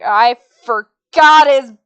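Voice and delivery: loud, drawn-out retold exclamation; mock outburst. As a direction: blurt it out loudly and stretch it. Acting out his loud reaction.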